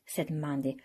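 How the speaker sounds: ordinary delivery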